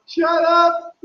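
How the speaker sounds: high-pitched mock yell; mock shout. Shouting back at someone singing, high mock yell. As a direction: yell it high, without much extra volume. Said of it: "if someone shouts".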